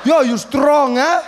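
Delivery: loud, high, amazed; amazed uncle. Retold or imitated. Drunk uncle marvels at the boy, loud and amazed. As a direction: marvel at it loudly.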